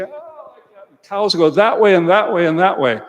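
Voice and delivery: high, loud, frantic mock panic; excited flustered farmer. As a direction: ham up a farmer scattering the cows. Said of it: "Don't get all excited".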